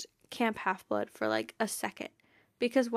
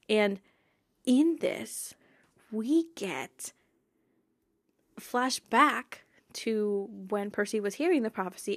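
ordinary talk

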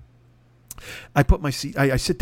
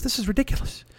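softer, higher aside; quiet grumble; 7 semitones higher, 6 dB quieter than usual. Retold or imitated. Pardo grumbles about the seats, softer and higher. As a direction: grumble it under your breath.

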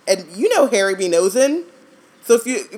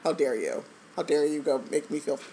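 mock indignation; 2 semitones lower, 8 dB quieter than usual. Mock-offended reply to child's love.

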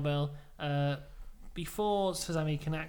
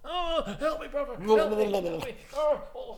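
fluttering cries for help; 6 semitones higher, 5 dB louder than usual. Retold or imitated. Hogan begging for help.

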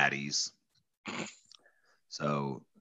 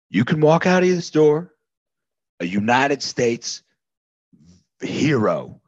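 threatening shout; 5 dB louder than usual. Acted. Corporal threatening Theodore, loud menacing ultimatum. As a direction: bark the threat at him.

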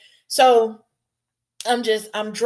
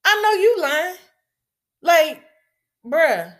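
incredulous denial; 5 semitones higher than usual. Retold or imitated. Sister told her brother was attacked, incredulous high denial. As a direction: pitch leaps up in stunned disbelief. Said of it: "And I'm like".

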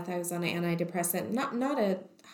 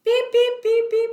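high, loud, repeated electronic beeps; imitated monitor beeping; 10 dB louder than usual. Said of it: "so then things start beeping".

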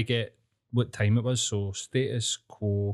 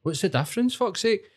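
exasperated; 8 semitones higher than usual. Real, not acted. Ranting about history hypocrisy.

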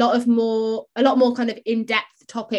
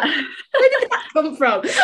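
laughing; 5 semitones higher than usual. Real, not acted. Laughing at odd Skittles remark.

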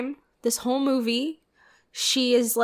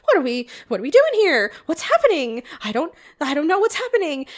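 louder, faster, higher confused rant; baffled; 5 semitones higher, 4 dB louder, faster than usual. Retold or imitated. Reacting to the plot twist, rushed baffled rant. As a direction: rattle off the confusion. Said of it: "And I was like".

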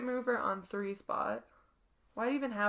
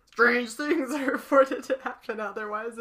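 laughing; 3 semitones higher, 8 dB louder than usual. Acted. Voices character, laughing.

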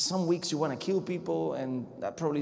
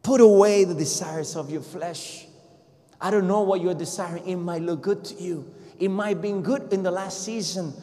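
rising sermon climax, louder with every line; fiery preaching; 5 semitones higher, 6 dB louder than usual. Acted. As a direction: drive it home from the pulpit. Preaching at full force.

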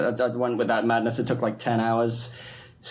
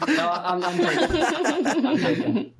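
laughing; 12 semitones higher, slower than usual. Real, not acted. Laughing fit after dog joke.

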